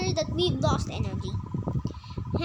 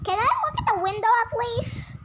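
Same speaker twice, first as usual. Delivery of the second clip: ordinary talk, nothing marked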